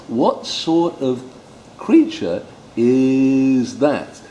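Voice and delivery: drawn out, curious question to the class; slow it down and let them wonder. Shown as a demonstration. Teacher asks the class, slow and curious. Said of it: "teacher demonstrating questioning children".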